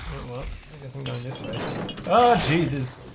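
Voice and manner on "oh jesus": jump-scared loud gasp, drawn out; startled. Real, not acted. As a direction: cry out as it makes you jump. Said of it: "That one actually made me jump".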